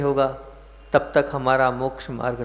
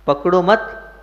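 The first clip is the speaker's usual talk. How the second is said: पकड़ो मत as one raised phrase; stress on पकड़ो मत; 5 semitones higher, 3 dB louder, slower than usual. Shown as a demonstration. Preacher shows where stress goes, पकड़ो मत joined and raised. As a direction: join मत firmly onto पकड़ो, pitched up. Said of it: "कहाँ जोर देना है".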